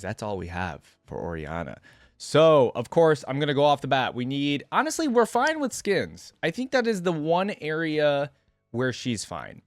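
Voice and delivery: normal talking voice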